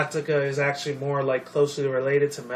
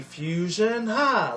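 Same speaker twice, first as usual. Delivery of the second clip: drawn-out anime catchphrase jumping high at the end; mock anime power-move chant; Dragon Ball Z fusion call; 7 semitones higher, much slower than usual. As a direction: stretch it out, then pop up high on the last cry. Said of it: "a Dragon Ball Z".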